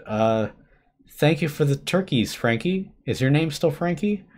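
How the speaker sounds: nervous. Acted. Narrator nervously addresses possessed friend.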